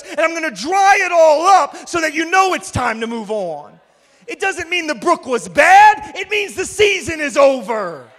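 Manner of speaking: loud, driving sermon voice with big swells; rising pulpit intensity; full-force preaching. Acted. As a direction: preach it hard to the room.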